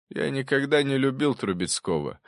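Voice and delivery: firm up as you confess your anger; voice growing firmer. A narrator's reading. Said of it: "Голос профессора окреп". (голос крепнет)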